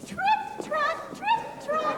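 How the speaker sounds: high, loud little hoofbeats; high little goat trit trot. Shown as a demonstration. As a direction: squeak it out like the tiny goat.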